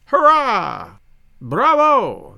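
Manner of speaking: loud ringing toast cheers. Acted. Old soldier and Englishman toasting, loud cheers. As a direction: shout the toast with glee.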